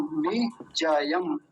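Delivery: Vijayam drawn out syllable by syllable; slow, careful. Shown as a demonstration. Teacher slows down so the class can copy, slowly. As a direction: stretch Vijayam slowly so students hear each sound. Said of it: "बड़ा ध्यान से बोलना है".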